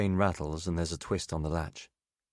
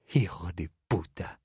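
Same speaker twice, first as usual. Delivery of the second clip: venomous whispered insult; whispered curse. Acted. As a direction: hiss the insult at him. Drunk woman curses him.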